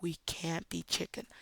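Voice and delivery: real quiet. Retold or imitated. Nervous kid to Luke beside him, real quiet whisper. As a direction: whisper it to your friend. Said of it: "I just went real quiet".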